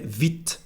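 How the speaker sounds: "vite" said veet, ending on T; silent final E. Shown as a demonstration. Teacher explaining the rule, "vite" said as veet. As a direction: stop "vite" on the consonant. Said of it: "we don't pronounce the letter E".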